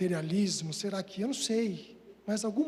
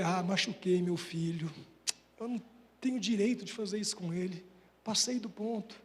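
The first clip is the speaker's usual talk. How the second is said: remorseful; 6 semitones lower, 8 dB quieter than usual. Retold or imitated. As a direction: murmur it to yourself, full of guilt. Remorseful inner speech, retold. (arrependido, falando baixinho)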